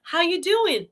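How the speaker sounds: put-on high little-kid echo; high toddler imitation; parroting toddler niece. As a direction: mimic a toddler parroting a question. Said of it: "She says".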